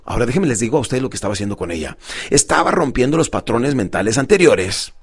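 usual speaking voice

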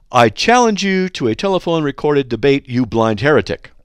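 loud, hammed-up reading of the challenge, blind heretic hit hard; mock menacing challenge. Shown as a demonstration. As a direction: ham up the hate mail challenge with relish. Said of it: "Now, that's just nice".

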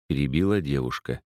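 usual speaking voice

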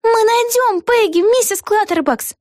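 put-on high-pitched, drawn-out little-girl voice; young heroine voice. A narrator's reading. Vega promising Mrs Clutterbucks they will find Peggy, high girlish voice.

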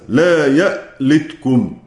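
slow "لا يألتكم" with the hamza in يألتكم clearly pronounced. Shown as a demonstration. Teacher shows students Abu Amr's reading, slowly sounding the hamza. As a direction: spell out يألتكم slowly, sounding its glottal stop. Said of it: "يقرأها أبو عمرو بالهمزة".

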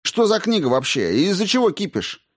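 high, heated questions; defensive outburst; irritated demand. A narrator's reading. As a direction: push back, exasperated. Defensive retort in the quarrel.

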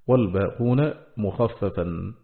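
ordinary talk, nothing marked

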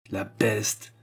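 grumbled. A narrator's reading. Monsieur Noël reacts to pupil's praise, grumbling. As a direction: grumble it to yourself. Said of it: "maugréa monsieur Noël".

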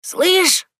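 put-on high, slow, teasing call of an old woman; mocking old woman's call. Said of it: "насмешливый, дребезжащий голос".

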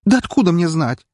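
high, agitated retort; exasperated outburst. A narrator's reading. Maid asked about the bottle, exasperated outburst. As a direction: throw the question back, upset. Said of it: "всплеснула она руками".

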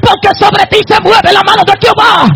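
high, driving shout building to a held cry; full-force preaching. Real, not acted. Preacher telling believers the devil could not kill them, climbing shout.

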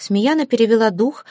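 ordinary delivery

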